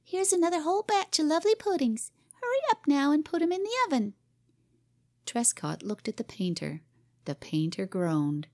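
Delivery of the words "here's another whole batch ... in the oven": put-on high, fast child's voice; busy little girl. A narrator's reading. Cora ordering Jim about her puddings, high and quick. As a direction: chirp it out like a bustling little cook.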